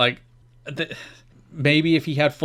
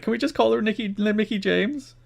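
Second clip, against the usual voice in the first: mocking co-host; 9 semitones higher than usual. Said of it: "It's like".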